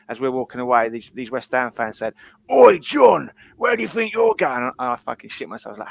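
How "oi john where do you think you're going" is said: loud, high mock yell; imitating rival fans' shout. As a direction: holler it across like a taunting football fan. Imitates rival fans calling out.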